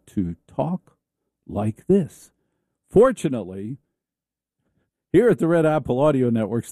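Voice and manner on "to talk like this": slow mock-anchor talk with a pause before "like this"; slow NPR delivery. Shown as a demonstration. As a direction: explain it slowly, as if to a dim listener. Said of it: "explained to them slowly".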